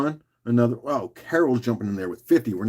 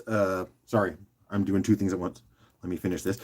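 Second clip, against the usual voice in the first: quiet aside; 5 semitones lower, 9 dB quieter than usual. Real, not acted. Distracted aside while doing something.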